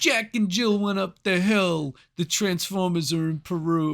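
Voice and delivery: hammed-up brash comic reciting a nursery rhyme; Andrew Dice Clay. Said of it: "Do an Andrew Dice Clay impression".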